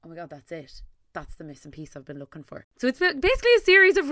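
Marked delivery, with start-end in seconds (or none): 0.0-2.7 dropped to a hushed, awed murmur; hushed realization. Retold or imitated. Listener's inner moment of realization, softly awed. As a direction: breathe it out like a quiet discovery. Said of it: "you went".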